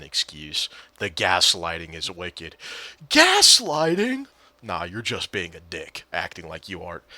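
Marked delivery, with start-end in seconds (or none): 3.1-4.3 loud, very high, drawn-out disbelief; incredulous outburst. Real, not acted. Reacting to teammate's gaslighting accusation, incredulous shriek. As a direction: shriek the word as a stunned question.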